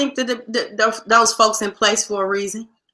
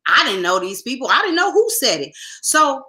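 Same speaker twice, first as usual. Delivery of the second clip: ordinary talk, nothing marked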